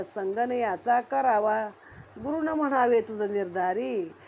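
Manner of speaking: usual speaking voice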